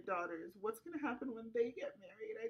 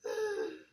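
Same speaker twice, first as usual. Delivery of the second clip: crying sigh; 11 semitones higher, 8 dB louder than usual. Acted. Client breaks down mid-session.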